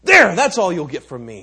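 loud, high, scornful; defiant beggar. Acted. Beggar tosses the Raja one grain, loud and scornful. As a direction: fling the words at him.

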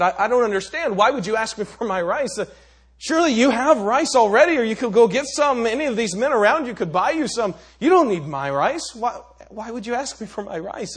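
loud, high, exasperated protest; indignant beggar. Acted. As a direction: beggar demands to know why the Raja wants his rice, loud and indignant. Beggar bothered, questions the Raja.

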